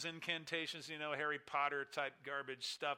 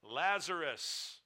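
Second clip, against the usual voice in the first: Lazarus drawn out slowly, louder, falling at the end; drawn-out name; 4 dB louder, much slower than usual. Shown as a demonstration. Preacher showing the congregation how Jesus named him, slow drawn-out call. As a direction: linger on Lazarus to show the personal call. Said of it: "Did you see the personalization?".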